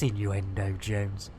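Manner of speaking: very low, grave pronouncement; deep catchphrase voice. Acted. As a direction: drop way down and announce it.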